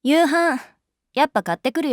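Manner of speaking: forced brightness. Acted. Teacher abruptly changes topic.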